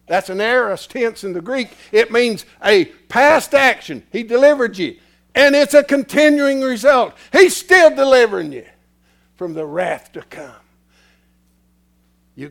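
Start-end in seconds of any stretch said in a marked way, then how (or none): 0.1-10.5 loud, high preaching; preaching at full force. Acted. Preacher explaining Greek tense to church, loud. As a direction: drive the Greek point home.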